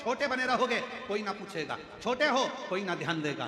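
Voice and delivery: high-pitched imitation of people's jeers; lightly acted taunt, starting high and easing down; mock taunting. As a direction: needle them as gossiping townsfolk would. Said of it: "लोग फिर ताना भी देना प्रारंभ करेंगे".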